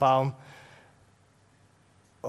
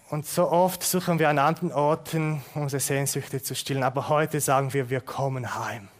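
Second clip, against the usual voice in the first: closing prayer. Real, not acted. (ruhiges Gebet)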